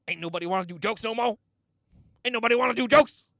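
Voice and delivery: fast, rising imitated outburst; mock angry rant. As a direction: build from annoyed to high, loud mock anger. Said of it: "he was so mad".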